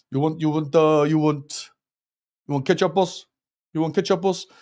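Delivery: lightly imitated Turkish accent; mock Turkish kebab-seller accent. Streamer recalls his kebab line, mock Turkish accent. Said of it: "it was a Turkish accent".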